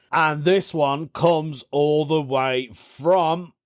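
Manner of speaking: ordinary talk, nothing marked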